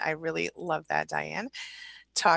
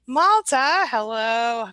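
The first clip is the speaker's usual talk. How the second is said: excited greeting; 4 semitones higher, 6 dB louder, much slower than usual. Real, not acted. Host spots returning viewer Malta.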